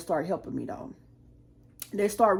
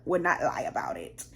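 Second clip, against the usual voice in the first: hushed, confiding whisper; whispered aside; 4 semitones lower, 5 dB quieter than usual. Real, not acted. Whispering to viewers that it's true.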